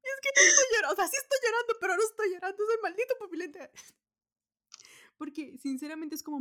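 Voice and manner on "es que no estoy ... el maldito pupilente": high, loud, half-crying protest; mock tearful. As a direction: whine like you're crying but deny it. Acting tearful denial about contacts. (protesta llorosa fingida, aguda y fuerte)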